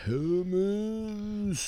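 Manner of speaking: drawn-out Hellmann's. Acted. Savoring the brand name.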